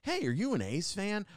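high, eager mock greeting; big friendly energy. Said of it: "big energy and nice coming over".

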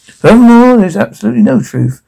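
high, forceful political denial; emphatic presidential address. Acted. Acted presidential address to the nation.

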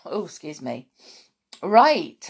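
plain reading voice

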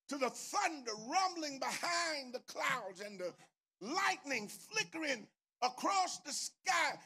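normal talking voice

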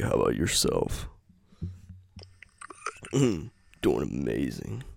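low, slow, deep [name] voice. Shown as a demonstration. Answering as [name] in a low deep voice. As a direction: drop very low and drag the words. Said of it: "Whatever you could say in that voice".

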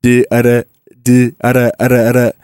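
"da" with a quick tongue tap; tongue-tap R demo. Shown as a demonstration. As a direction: tap "da" on the roof of the mouth like a D. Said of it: "tap of the tongue".

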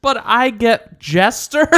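high, loud, slow disbelief; indignant surprise. Real, not acted. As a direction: protest in outraged disbelief. Indignant protest.

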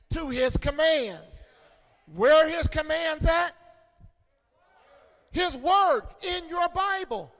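high, shouted phrases with hard punches; full-force preaching. Real, not acted. Preacher urging church to lift up Bibles, shouted preaching. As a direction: shout it out to rouse the congregation.

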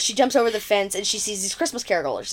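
normal talking voice

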